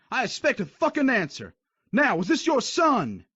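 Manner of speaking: high shouted interrogation; furious drill sergeant. Acted. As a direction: demand an answer screaming.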